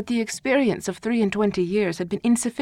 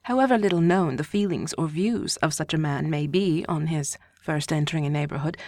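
ordinary delivery

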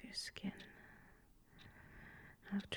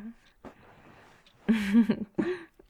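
loud, breathy burst of laughter; amused laugh; 3 semitones higher, 13 dB louder than usual. Acted. Laughing with a lover about being sappy at night, amused. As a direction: laugh out loud, rising at the end.

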